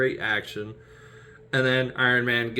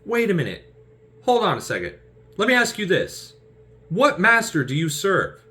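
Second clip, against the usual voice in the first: suspicious questioning; 11 semitones higher, 6 dB louder than usual. Retold or imitated. Star-Lord quizzing Peter, higher and louder. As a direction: narrow in and interrogate. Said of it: "he's just like".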